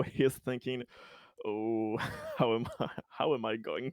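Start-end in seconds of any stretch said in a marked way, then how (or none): none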